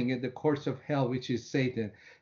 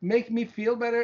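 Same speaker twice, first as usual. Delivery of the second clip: exasperated plea; 8 semitones higher than usual. Retold or imitated. Retold exasperated prayer.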